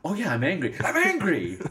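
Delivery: high, building to an emphatic outburst; hammed-up outrage, climbing in pitch; growing mock anger. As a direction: imitate someone revelling in new anger. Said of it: "And then she gets".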